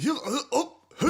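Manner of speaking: mock the guard catching someone laughing; imitated guard. Security guard catching a laughing guest, lightly voiced. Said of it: "Yeah, like".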